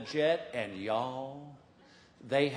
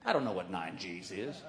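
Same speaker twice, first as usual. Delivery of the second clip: usual speaking voice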